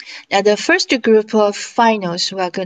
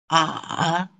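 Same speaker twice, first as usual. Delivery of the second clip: "A" dipping low, then rising at the end; drawn-out "A" that sinks and turns back up; third tone; 4 semitones lower, 4 dB quieter than usual. Shown as a demonstration. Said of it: "imitate the tones".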